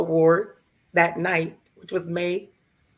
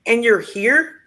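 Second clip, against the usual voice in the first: higher, louder disbelieving question; incredulous nurse; 5 semitones higher, 6 dB louder, slower than usual. Retold or imitated. Nurse to widow at surgery check-in, in disbelief. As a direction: repeat it like you can't believe she came. Nurse hears husband just died.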